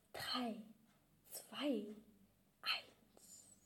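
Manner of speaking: fading to a whisper; whispered countdown. Acted. Counting down before the prank. (geflüsterter Countdown)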